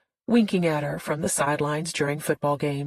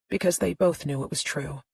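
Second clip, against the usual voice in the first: ordinary delivery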